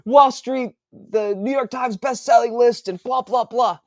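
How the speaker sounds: high, loud pompous bragging voice; mock boast. Author mocking his own bragging, high pompous voice. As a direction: puff himself up like a bestseller. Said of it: "I'm like".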